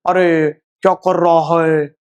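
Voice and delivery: slow, distorted vowels in अरे क्या कर रहा है; mock mispronounced Hindi at half speed; badly pronounced Hindi. Shown as a demonstration. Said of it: "आप हिंदी अगर ऐसे बोल रहे हो".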